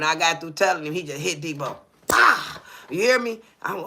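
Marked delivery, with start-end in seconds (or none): 2.1-2.6 sharp, explosive "pah"; sudden burst of air for a blow; imitated punch sound. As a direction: voice the smack of a hit. Imitates the blow hitting Debo.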